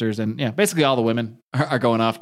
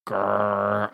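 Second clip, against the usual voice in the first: low mock zombie grr; lightly imitated zombie growl; 4 semitones lower, 3 dB quieter than usual. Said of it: "the zombie looks up and he's like".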